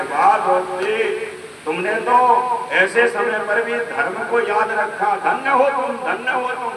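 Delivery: high-pitched, exalting praise; Yudhishthira praising. Retold or imitated. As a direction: exclaim your admiration, voice lifted. Acts Yudhishthira praising Draupadi. (ऊँचे स्वर में धन्यवाद भरी प्रशंसा)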